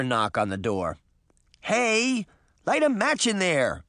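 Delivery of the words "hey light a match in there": put-on high kid-sister call; mild high-pitched shout. A narrator's reading. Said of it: "Daphne shouted".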